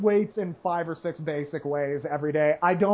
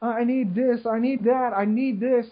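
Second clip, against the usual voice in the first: higher whining list of demands; mock whiny millennial; 5 semitones higher than usual. Mocking college debt complaints, higher and whiny. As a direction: whine like a spoiled complainer.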